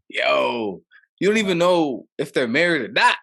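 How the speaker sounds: high, loud, exasperated objection; indignant protest. Retold or imitated. Husband objecting to gossip about a passing couple, indignant. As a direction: protest it with rising disbelief.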